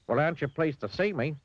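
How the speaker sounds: high, cheery storybook voice; lightly acted chirpy visitor; cheerful. A narrator's reading. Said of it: "continued Mister Clumsy cheerfully".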